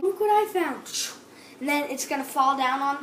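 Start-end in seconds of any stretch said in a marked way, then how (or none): 0.0-0.9 put-on excited kid. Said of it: "you're just gonna take it".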